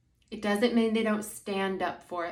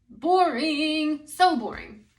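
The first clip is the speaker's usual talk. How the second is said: drawn-out, higher and louder mock groan at how dull it would be; exaggerated boredom; 7 semitones higher, 5 dB louder, slower than usual. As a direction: whine it like a bored teenager. Mocking a dull, uniform world.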